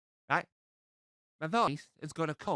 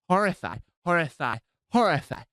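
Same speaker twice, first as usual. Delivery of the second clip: louder on each horrify; repeated emphasis; 2 semitones higher, 7 dB louder than usual. Acted. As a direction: hammer the word horrify. Stuck repeating word.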